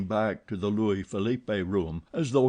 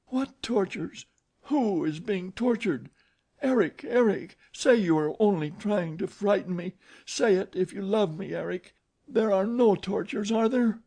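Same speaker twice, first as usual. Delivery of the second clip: much higher, panicked plea; terrified pleading; 11 semitones higher than usual. Acted. Christine begging Eric in terror. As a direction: plead desperately for it to be untrue.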